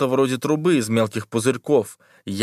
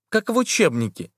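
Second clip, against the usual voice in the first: put-on high, uneasy young girl; lightly voiced nervous schoolgirl; scared girl voice; 7 semitones higher than usual. A narrator's reading. Liza's frightened line. (испуганный девичий голос)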